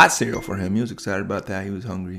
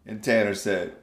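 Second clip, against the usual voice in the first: plain reading voice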